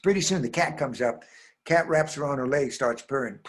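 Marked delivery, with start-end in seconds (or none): none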